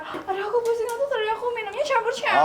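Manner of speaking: high mock-tipsy whine; drunk. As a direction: imitate a girl faking drunk. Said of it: "ya udah pura-pura".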